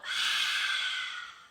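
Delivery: big open-mouthed sigh letting it all out. Shown as a demonstration. Teacher showing children how to let breath out, loud sigh. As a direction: push the air out in a long sigh. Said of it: "open the mouth, let it all out".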